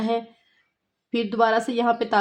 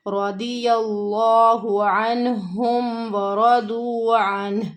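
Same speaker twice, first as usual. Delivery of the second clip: slow, drawn-out melodic tajweed recitation; Quran recitation; much slower than usual. Shown as a demonstration. Teacher recites the verse for her students, slow and melodic. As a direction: chant each word long and held.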